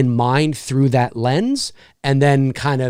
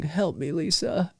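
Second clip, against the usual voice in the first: much softer, breathy plea; pleading Freddy; 2 semitones higher, 10 dB quieter, slower than usual. Retold or imitated. Freddy softly pleading to Lisa, much softer. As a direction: hush it as a pleading dream demon.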